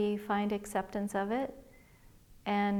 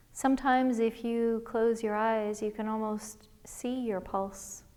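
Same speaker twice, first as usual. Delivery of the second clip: guided meditation. Acted. Liz leading listeners through a body scan, calm guided meditation voice. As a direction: gently guide listeners inward, one breath at a time.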